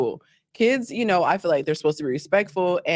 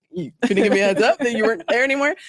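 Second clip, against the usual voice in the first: higher, faster complaint; mock annoyed retort; 4 semitones higher, faster than usual. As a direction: snap it back with irritation. Retorting to the boyfriend on the phone.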